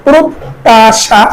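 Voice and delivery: প্রত্যাশা drawn out as "prot-taa-shaa"; doubled t. Shown as a demonstration. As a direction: slowly split প্রত্যাশা into syllables. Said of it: "তদ্বিত্ত উচ্চারণ হবে". (প্রত্যাশা টেনে "প্রোত্-তা-শা")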